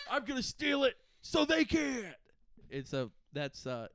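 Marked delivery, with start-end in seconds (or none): 0.1-2.1 high-pitched hammed-up resolve; Nick Cage. Playing the hero's plan, hammed-up high declaration. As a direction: announce it like an over-excited movie hero. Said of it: "Nick Cage is like".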